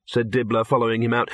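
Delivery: usual speaking voice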